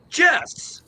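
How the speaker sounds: high, sharp call of a name; imitating the teacher snapping her name; stern teacher call. Teacher calling the student out by name, sharp.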